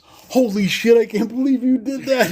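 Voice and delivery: high, loud laughing disbelief as the principal; amazed principal. Retold or imitated. Principal amazed by pupil's prank, laughing disbelief. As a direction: crack up in disbelief. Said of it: "And he goes".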